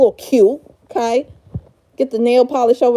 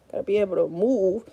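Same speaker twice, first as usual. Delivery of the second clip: softer and quicker, said to herself; muttered repeat; 3 semitones lower, 8 dB quieter, faster than usual. Real, not acted. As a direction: murmur it again, fast, under your breath. Repeating to herself while arranging.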